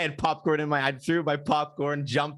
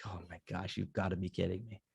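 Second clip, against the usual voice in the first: much softer, near-whispered despair; hushed disbelief; 5 semitones lower, 12 dB quieter than usual. Retold or imitated. Retelling the wasp ordeal to a friend: hushed groan. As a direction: drop to a breathy hush of disbelief.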